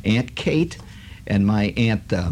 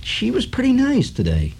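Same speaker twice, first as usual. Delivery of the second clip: mother's dry remark, voice pitched higher; wry mother; 11 semitones higher than usual. Retold or imitated. Mother remarking after the aunt leaves, dry and higher. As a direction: say it deadpan, a touch higher. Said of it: "my mother says".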